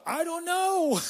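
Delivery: high, slow, drawn-out whimper of cluelessness; sheepish helplessness. Retold or imitated. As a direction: play it utterly lost and a little pathetic. Helpless answer again.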